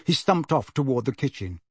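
ordinary delivery, nothing marked

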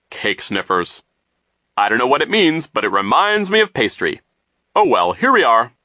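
loud, swooping, jovial adult voice; pompous and cheerfully clueless; oblivious banker figure. A narrator's reading. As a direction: muse aloud, pleased with yourself.

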